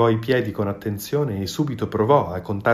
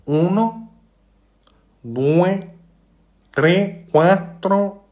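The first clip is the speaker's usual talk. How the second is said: high, loud, eager goose voice; silly goose counting; 8 semitones higher, 4 dB louder, much slower than usual. A narrator's reading. The goose obediently counts for the fox, high and eager. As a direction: count proudly like a simple goose.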